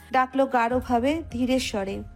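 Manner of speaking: ordinary delivery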